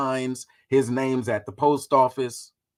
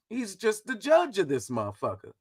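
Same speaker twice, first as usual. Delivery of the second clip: normal talking voice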